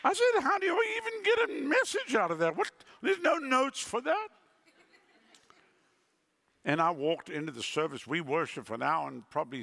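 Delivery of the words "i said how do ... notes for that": incredulous. Retold or imitated. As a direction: blurt out in outrage. Stunned reaction to message.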